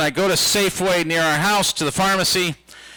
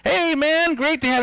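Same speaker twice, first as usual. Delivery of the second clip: bright, higher, eager hello; overly friendly greeting; 10 semitones higher than usual. Retold or imitated. Comic greeting a fan's friend, overeager and high. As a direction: greet the stranger with big enthusiasm. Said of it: "I said to his friend".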